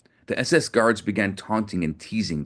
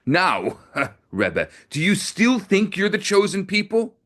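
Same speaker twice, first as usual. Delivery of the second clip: higher, a rising enraged taunt; 11 semitones higher than usual. Acted. Officer raging at the beaten rabbi, angry taunt. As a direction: spit the question in fury. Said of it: "there was a rage in the officer's voice".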